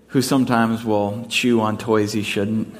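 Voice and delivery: ordinary talk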